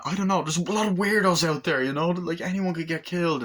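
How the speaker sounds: high-pitched imitated excuse; mock defensive protest. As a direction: plead innocence high, acting it out. Acts suspect making excuses.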